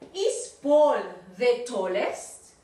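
Is Paul the tallest, said very slowly; teacher modelling a question slowly for the student; slow model question. Shown as a demonstration. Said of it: "yo juego con armar esto".

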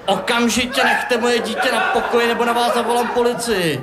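mother scolding a stranger; angry mother. Acted. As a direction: threaten him like a protective mum. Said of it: "řekla paní".

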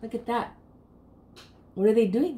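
ordinary delivery

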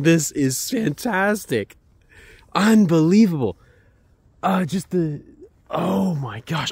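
high-pitched, real amazement; excited. Real, not acted. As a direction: gush in wonder, stretching the big exclamations. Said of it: "I am excited".

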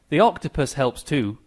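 ordinary delivery

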